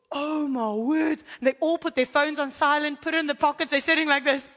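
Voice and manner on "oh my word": astonished reaction. Retold or imitated. Retold listeners' shocked reaction.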